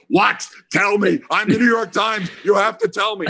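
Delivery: high-pitched, self-important mock demand; frantic demanding impression. Janofsky grabbing Dave for the story, mock frantic demand. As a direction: imitate a desperate reporter insisting on the scoop.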